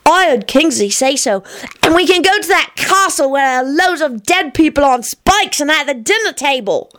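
put-on high, excited little-kid chatter; high-pitched childish enthusiasm; delighted child. A narrator's reading. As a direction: gush eagerly like a small child. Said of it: "Teddy shrieked delightedly".

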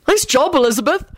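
high-pitched grudging praise; lightly grudging compliment. A narrator's reading. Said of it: "Ron said grudgingly".

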